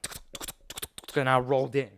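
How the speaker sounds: soft clicking hoofbeats, voiceless; imitated horse hooves. Kid riding an imaginary horse to a friend, soft hoof clicks. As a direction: click out a little gallop. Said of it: "I just got on my horse".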